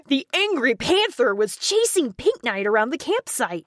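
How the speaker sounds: normal talking voice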